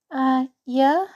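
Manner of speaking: put-on a nervous teen hedging; lightly voiced uneasy teenage girl. A narrator's reading. Uncomfortable girl answers.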